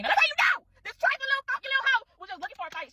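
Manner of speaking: high, loud indignant rant; outraged. Real, not acted. Telling viewers the girl just wanted a fight, outraged. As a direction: squeal it out in disbelief.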